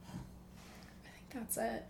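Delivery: slow soft whisper; whispered wrap-up. Real, not acted. Wrapping up the episode, slow whisper. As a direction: trail off in a whisper.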